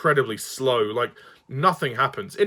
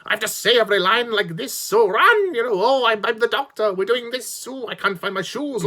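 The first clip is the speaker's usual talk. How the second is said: mocking hammy Doctor; 9 semitones higher than usual. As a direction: ham it up like a prancing eccentric hero. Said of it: "in that tone of voice, in that pitch".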